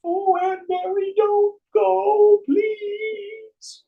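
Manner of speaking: high, loud, mock-desperate wail; wailing plea. Relatives begging dying Aunt Mary not to go, high mock wail. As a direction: imitate a clinging relative begging.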